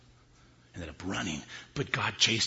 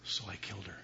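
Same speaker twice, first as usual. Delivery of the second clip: husband calmly admitting the murder; quiet confession; 6 dB quieter, faster than usual. Acted. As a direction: say it flatly, softly.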